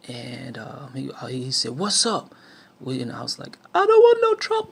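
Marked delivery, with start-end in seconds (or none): 1.8-2.3 menacing challenge. Retold or imitated. Gunman challenging them again. 3.7-4.7 very high, loud, terrified squeak like Alfalfa; scared squeaky voice. Retold or imitated. Facing a gun, he squeaks out a terrified plea in a very high voice. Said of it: "my voice was so high".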